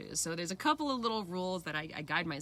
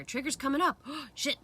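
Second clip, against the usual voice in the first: sudden alarm; 8 semitones higher, 6 dB louder than usual. Retold or imitated. Acted alarm at own trigger.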